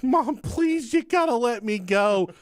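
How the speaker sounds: put-on high, whiny kid pleading with mom; begging mom. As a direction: beg like a desperate teenager.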